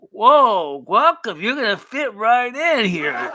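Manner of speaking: loud, high, swooping welcome; hammed-up welcome into her world; mock welcome. Imitating her letting newcomers into her world, hammed-up and loud. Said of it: "when she let us into her world, it was like".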